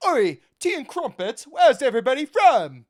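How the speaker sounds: loud, high hammed-up English accent. Mocking a bald announcer asking where everybody's from, hammed-up English accent. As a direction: holler it like a caricature English announcer. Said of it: "That's my best English accent".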